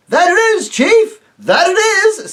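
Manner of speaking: high, loud, eager agreeing roar; the other voices roar their eager agreement; roaring chorus. Acted. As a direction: shout it like a crowd of cheering yokels. Others echo the chief.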